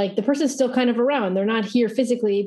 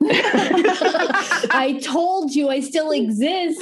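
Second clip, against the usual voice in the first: high, very slow mock ghost voice through laughter; laughing ghost; 6 semitones higher, much slower than usual. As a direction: play the haunting ghost but crack up.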